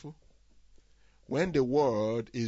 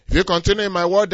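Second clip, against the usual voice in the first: If you continue in my word, loud, higher, fast; booming proclamation; 9 semitones higher, 7 dB louder, much faster than usual. Shown as a demonstration. Preacher proclaiming Jesus' condition to the congregation, booming. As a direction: proclaim it to the back row. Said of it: "If, there's a condition there".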